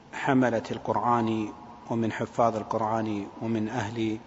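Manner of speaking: usual speaking voice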